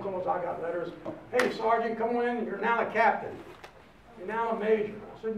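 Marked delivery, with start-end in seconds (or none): 1.3-4.9 louder, higher, booming "come on in"; loud officer's call. Retold or imitated. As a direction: hail him like a hearty officer. Officer urging him to re-enlist.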